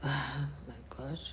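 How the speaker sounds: whispered under her breath, slow; a real, hushed oh-my-gosh of despair; distressed whisper. Real, not acted. Whispering in distress to her livestream viewers. Distressed during failing live prayer.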